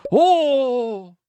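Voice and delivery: high, drawn-out "Oh!"; thrilled squeal of discovery; excited oh. Real, not acted. Streamer squeals at a pillow combination result.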